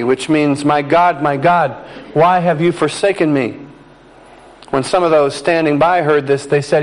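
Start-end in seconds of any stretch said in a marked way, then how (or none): none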